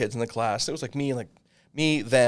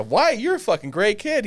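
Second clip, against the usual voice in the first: excited praise; 10 semitones higher, 6 dB louder than usual. Retold or imitated. Voicing himself praising Wyatt.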